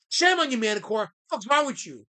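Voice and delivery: ordinary delivery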